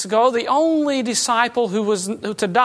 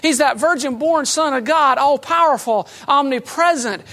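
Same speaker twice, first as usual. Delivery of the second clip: usual speaking voice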